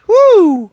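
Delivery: high loud whoop; high whoop to call dog. Real, not acted. Calling the dog with a high loud whoop. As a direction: whoop to get the dog's attention. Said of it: "I can't whistle very well".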